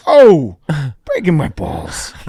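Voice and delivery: loud "Oh", then slow complaint; Christopher Walken. Retold or imitated. Star complains of being mocked, imitated gripe. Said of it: "He goes".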